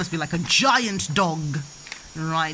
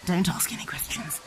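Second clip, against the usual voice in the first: hushed, voiceless whisper; whispered aside; 3 semitones lower, 5 dB quieter than usual. Real, not acted. Mum's aside about the farm next to the hospital, whispered. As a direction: whisper it softly as a sly aside.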